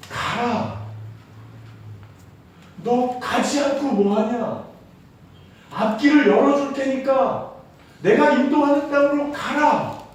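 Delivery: high, loud acted divine order; commanding. God ordering his people forward, commanding. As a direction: urge them onward with mounting force.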